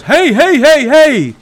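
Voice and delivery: high, loud mock backpedalling; alarmed neighbour. Neighbour raises hands, alarmed by threat.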